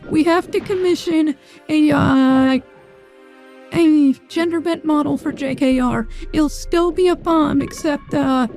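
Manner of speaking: feminine voice-changer filter. Real, not acted. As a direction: talk through a female voice model. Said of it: "I'm in my female model now".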